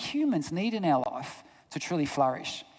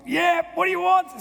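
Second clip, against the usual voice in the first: high, louder exasperated reply; irritated dad; 12 semitones higher, 5 dB louder than usual. Retold or imitated. Busy father answering his daughter while weeding, mock irritated. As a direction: fling it back at a pestering child.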